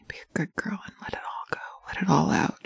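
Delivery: fast urgent whisper. Acted. Partner urging the listener to let go, fast whisper. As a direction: tumble the words out in a hushed rush.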